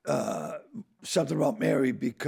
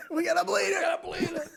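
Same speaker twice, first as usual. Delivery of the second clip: laughing high mock line; faster than usual. Retold on-set line.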